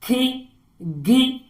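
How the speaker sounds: खे, गे drawn out slowly; slow sound demo. Shown as a demonstration. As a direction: stretch खे and गे. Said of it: "जैसे के". (धीरे खींचकर खे, गे)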